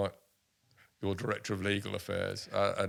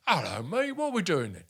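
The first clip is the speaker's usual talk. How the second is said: much higher, cheery mock greeting; lightly voiced friendly footballer; Vinnie Jones voice; 9 semitones higher, 4 dB louder than usual. Vinny answers the phone, retold.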